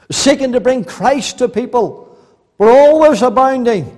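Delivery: loud, ringing pulpit delivery; fervent sermon voice; full-force preaching. Acted. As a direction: preach it out to the whole hall. Preacher exhorting the congregation.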